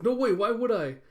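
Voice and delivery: loud, high mock protest; indignant refusal. [name] refuses to teach cooking, loudly indignant. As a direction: refuse outright, imitating someone offended.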